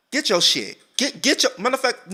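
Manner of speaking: high, insistent mock orders; bossy mama. Imagined mama dragging daughter out, bossy.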